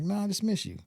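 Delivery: high-pitched, emotional brush-off; retold emotional denial. Telling grandmother he just missed her, high emotional denial. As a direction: re-enact a choked-up excuse, pitched well up. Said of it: "I get emotional".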